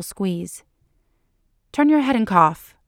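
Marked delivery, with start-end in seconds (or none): 1.7-2.7 higher, louder haughty order. A narrator's reading. Doctor role-play instruction to her patient, haughty. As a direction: give the order as if above him. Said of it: "she says haughtily".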